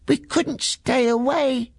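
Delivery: put-on cheeky kid goats calling over; high slow cheeky goat voice; little goats shouting. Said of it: "shouted the little goats".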